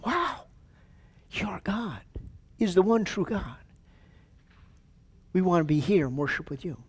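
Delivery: hushed, wondering voice; awed onlookers. Acted. Nations marvelling at Israel's God, hushed awe. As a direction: softly act out amazed newcomers. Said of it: "people are gonna be coming to him saying".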